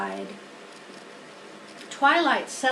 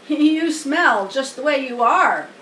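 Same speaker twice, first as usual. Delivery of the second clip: a lightly voiced lamb, louder and taunting; mocking lamb; 3 semitones higher, 7 dB louder than usual. A narrator's reading. Lamb walking in teases the pig, loud taunt. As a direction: sneer at the pig.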